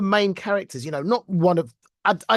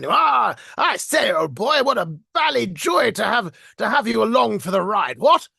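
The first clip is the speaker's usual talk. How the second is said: put-on booming old-fashioned upper-class British toff, pitched high; hammed-up posh British accent, loud and swooping; 4 semitones higher, 5 dB louder than usual. Shown as a demonstration. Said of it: "very stereotypical British upper class accent".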